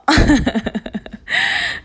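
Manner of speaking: long, loud burst of laughter; real self-amused laughing fit; embarrassed laugh. Real, not acted. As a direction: laugh at losing your own thread. Laughs at forgetting her topic.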